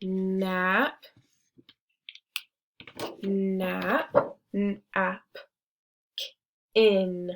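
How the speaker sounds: "Nap, nap, nap, in", each syllable on its own; sounding out slowly. Shown as a demonstration. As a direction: spell out the syllables with pauses. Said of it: "So what comes first?".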